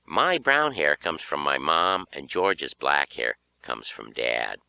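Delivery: plain reading voice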